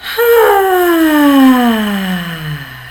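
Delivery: easy relaxed sigh; contented sigh. Shown as a demonstration. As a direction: breathe out gently and happily. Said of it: "really gentle, contented".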